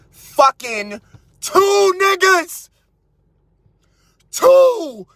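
exaggerated disbelief; stress on two. Emphatic outrage at count.

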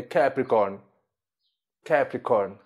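"Capricorn" said in English, twice, falling; English pronunciation. Shown as a demonstration. Teacher shows students Capricórnio in English, English pronunciation. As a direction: model "Capricorn" as CAP-ri-corn. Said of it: "se escreve quase igual também".

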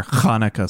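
"Hanukkah" with a heavy throat-scraped first sound; exaggerated Hanukkah pronunciation. Real, not acted. Recalling a Rugrats holiday special, "Hanukkah" with an exaggerated guttural H. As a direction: rasp the opening H of "Hanukkah" in the throat. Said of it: "why'd you have to say it like that?".

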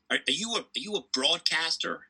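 a light American accent, a mild put-on; American accent impression. Commentator imitating American partner, light American accent. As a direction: ask it the way Jim Courier would, in his American accent. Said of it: "I'll try and do my best impersonation".

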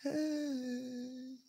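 high, very soft, long-held holler; faint, drawn-out far-away hey; distant answering call. Retold or imitated. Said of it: "off in the distance, I heard".

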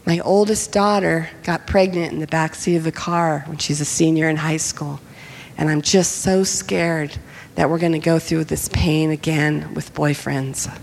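low, heavy, understated worry; subdued confession. Retold or imitated. Father confessing fear for his daughter, retold low and subdued.

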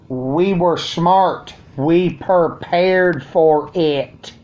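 very slow, each word weighed; slow emphatic. Real, not acted. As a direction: drag it out proudly.

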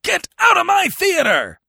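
huge high furious bellow. Acted. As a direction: blast it at the actor across the stage. Furious manager throwing actor out.

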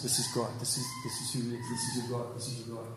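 a reverent murmur fading away; hushed declaration. Acted. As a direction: let it sink to a reverent hush. Preacher declaring God softly.

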